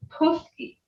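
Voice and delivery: Puski with a puffed English P; common mispronunciation. Shown as a demonstration. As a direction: say Puski with a burst of air on P. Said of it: "I've heard this too".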